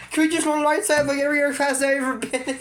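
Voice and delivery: high, fast complaining student; mock exasperation. Student begging teacher for a normal classroom, mock exasperation. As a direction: rush it out as a protest.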